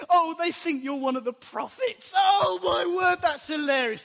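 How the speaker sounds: high, shouted mock amazement; hammed-up hilarity. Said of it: "another one chips in".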